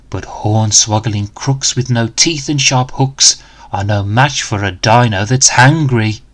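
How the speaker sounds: ordinary delivery, nothing marked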